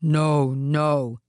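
low, loud ogre refusal; insistent ogre; wicked Oni, insistent. A narrator's reading. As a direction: contradict firmly in a low voice. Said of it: "said the wicked Oni".

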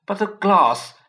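high, loud disbelief; stunned, repeating in shock; shocked keeper. A narrator's reading. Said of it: "he kept saying".

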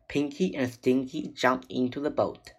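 plain reading voice

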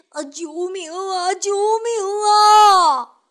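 high, drawn-out desperate cry; panicked call for help. Acted. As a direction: wail for help from the water. Someone swept into river.

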